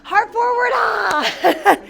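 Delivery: high, loud call breaking into a cry; loud coaching shout. Real, not acted. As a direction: shout it out to urge a student on. Coach calling out to student.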